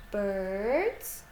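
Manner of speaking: usual speaking voice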